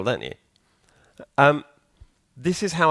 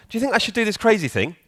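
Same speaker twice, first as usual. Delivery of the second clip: much higher, make-believe asking voice; playful mock question; 10 semitones higher, faster than usual. Programmer asking his bath duck for advice, high playful question. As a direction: pitch it up high as if consulting a toy.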